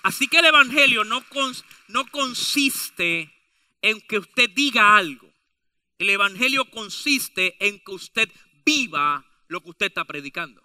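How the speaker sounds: normal talking voice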